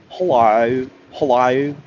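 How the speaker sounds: "Hello" with a weird Australian 'ai-oo' ending; mock-Aussie "Hello", second vowel widened to 'eye-oo'. Shown as a demonstration. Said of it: "like, a weird vowel sound".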